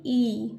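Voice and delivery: ई as a drawn-out ee; long sound. Shown as a demonstration. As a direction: hold the vowel in ई. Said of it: "long sound होता है". (ई को लंबा खींचकर)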